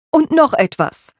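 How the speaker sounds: high, hard housekeeper voice stopping at the door; iron-hard. Acted. Housekeeper turns back to the maid, steely. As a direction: turn back with a steely warning. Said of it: "Afras Blick wurde eisern und hart".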